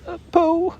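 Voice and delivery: timid, nervous start; nervously. Acted. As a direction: Piglet nervously turning to Pooh. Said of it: "he went on nervously".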